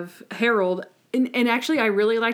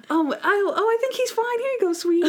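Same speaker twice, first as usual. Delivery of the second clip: much higher, sugary awkward voice; mock Natalie; 12 semitones higher, much faster than usual. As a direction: soothe the child nervously. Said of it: "Natalie's like".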